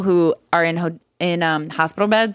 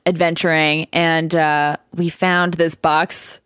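usual speaking voice

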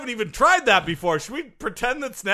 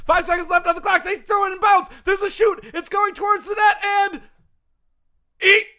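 sports announcing; 6 semitones higher than usual. Acted.

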